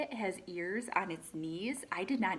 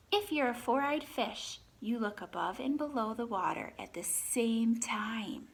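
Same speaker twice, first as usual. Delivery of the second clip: lively read-aloud, animal names lifted; reading to children; 3 semitones higher, 4 dB louder than usual. Acted. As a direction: read the book to the class, naming each animal brightly. Teacher reads picture book aloud.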